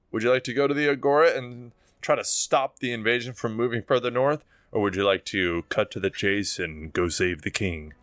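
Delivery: ordinary talk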